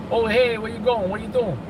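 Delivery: loud, high New York greeting; New York accent. Shown as a demonstration. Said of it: "Oh, Italian accent".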